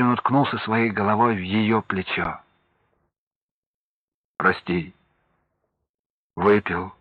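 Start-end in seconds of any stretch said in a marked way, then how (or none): 4.4-6.9 repentant. Acted. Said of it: "покаялся он".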